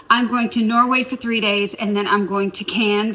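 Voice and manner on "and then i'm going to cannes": ordinary talk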